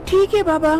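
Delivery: put-on high, sweet young woman's voice; young wife voice. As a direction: answer the old healer like a young bride. Manisha answers the healer.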